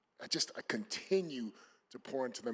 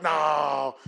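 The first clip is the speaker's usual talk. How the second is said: a sudden loud, higher "No"; loud retold no; 4 semitones higher, 13 dB louder than usual. Father refusing his sons' excuse for him, loud and high.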